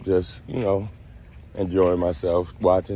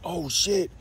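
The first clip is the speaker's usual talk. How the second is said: high, startled gasp of fear; scared; 10 semitones higher than usual. Retold or imitated. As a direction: caught in sudden panic. Door kicked in.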